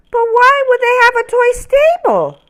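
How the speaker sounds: very high, small, curious voice; little ornament voice. Acted. Ornament Noel asks the big tree, high and curious. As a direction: ask like a tiny wondering ornament. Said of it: "Noel wanted to know".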